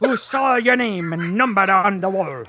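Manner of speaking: Scottish accent. Shown as a demonstration. Host trying song lyrics in Scottish, comic Scottish accent. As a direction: give the lyrics a playful Scottish lilt. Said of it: "do it with a Scottish accent".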